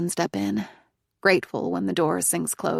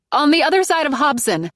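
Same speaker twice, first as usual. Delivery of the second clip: higher and louder repeat; 9 semitones higher, 7 dB louder than usual. Acted. Narrator repeats to unhearing driver, louder. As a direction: push it out over the rain. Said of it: "I say louder now".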